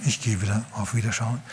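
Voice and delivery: Jesus leaving meekly, soft and humble; gentle and humble. Acted. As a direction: take your leave gently, without grudge. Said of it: "sanftmütig und demütig".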